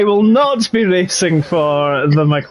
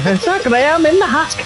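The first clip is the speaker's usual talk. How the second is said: higher, a bit brighter take of the [name] line; retry the [name] voice, a little more out; 9 semitones higher than usual. Retold or imitated. Commentator redoes his [name] intro after saying it was quiet, higher voice. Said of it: "So quiet".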